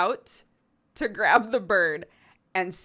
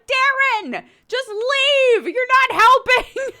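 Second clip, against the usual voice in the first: high, loud, fed-up shout with a crack of laughter; exasperated yell; 13 dB louder, slower than usual. Retold or imitated. Wife ordering her husband out of the room, a high, loud, fed-up yell. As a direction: snap at him to get out.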